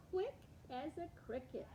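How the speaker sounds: ordinary delivery, nothing marked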